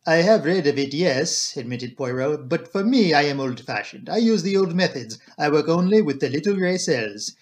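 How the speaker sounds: deliberate Belgian detective voice; modest, assured Poirot. Acted. Detective answering talk of machines, self-assured.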